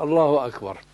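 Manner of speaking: الله أكبر snatched short and brisk; quick clipped takbir. Shown as a demonstration. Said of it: "وإنما".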